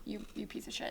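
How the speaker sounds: hushed, barely voiced jab; muttered insult. Imitating the mean dentist to a patient, muttered insult. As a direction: drop it under your breath as an aside.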